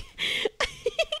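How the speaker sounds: shrieking laugh; high laugh. Real, not acted. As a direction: burst into squealing laughter. Laughing at creeper blast.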